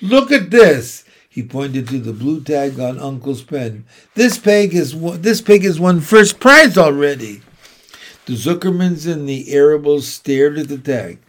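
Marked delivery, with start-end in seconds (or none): 0.0-1.0 high, loud call; crying out. A narrator's reading. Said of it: "cried Avery". 4.1-7.4 loud, higher exclamation; crying out. A narrator's reading. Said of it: "cried Avery".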